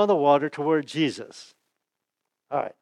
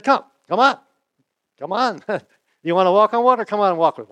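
higher, louder, playful come-on; eager invitation; 4 semitones higher, 4 dB louder than usual. Retold or imitated. Jesus urging Peter out of the boat, playful eager call. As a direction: wave him over with glee.

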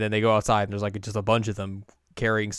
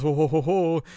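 mock jolly ho ho ho; 5 semitones higher, 4 dB louder than usual. Jolly laugh in retold scene.

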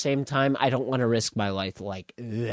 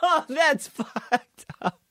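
laughing; 12 semitones higher, slower than usual. Real, not acted. Shocked laughing reaction.

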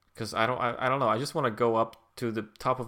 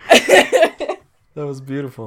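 loud, slow words through real laughter; laughing praise; 3 semitones higher, 13 dB louder, much slower than usual. Real, not acted. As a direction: praise it while still cracking up.

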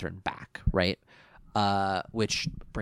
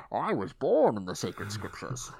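Bane voice; 4 semitones higher than usual. Retold or imitated. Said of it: "He does pull a Bane".

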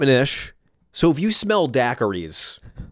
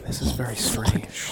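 whisper; 7 dB quieter than usual. Real, not acted. Whispered aside after dark joke.

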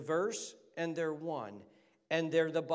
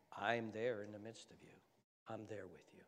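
low, hushed and gentle; Jesus; 5 semitones lower, 9 dB quieter than usual. Acted. Preacher quoting Jesus to his congregation, low and soft. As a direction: say it softly and low, as a quiet promise. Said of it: "Jesus talked like this".

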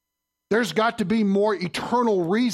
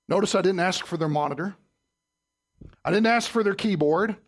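ordinary delivery

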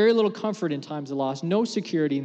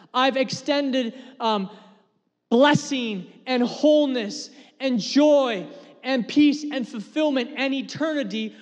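high, loud preaching build; forceful preaching; 8 semitones higher, 4 dB louder than usual. Acted. Pastor preaching hard to his church.

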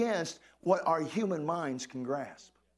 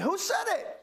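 call for response; 10 semitones higher than usual. Real, not acted. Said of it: "say it with me".

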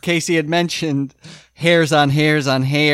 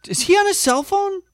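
high squeaky mock voice. Mocking the TV scene.

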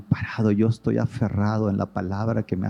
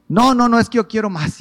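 very high, loud mock insistence; stubborn refusal; 12 semitones higher, 6 dB louder than usual. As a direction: play the stubborn seller. Client refusing, imitated.

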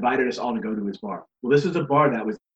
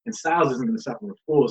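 usual speaking voice

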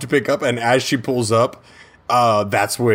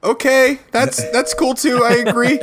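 put-on high, halting kid voice; bluffing agreement; 11 semitones higher than usual. Boy pretends to follow mom's garbled call, high and halting. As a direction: agree vaguely, pitched high, half laughing.

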